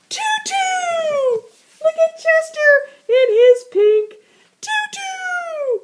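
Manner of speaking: giddy high squeal over the tutu; gleeful shriek. Acted. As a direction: shriek with delight.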